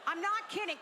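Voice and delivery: ordinary talk, nothing marked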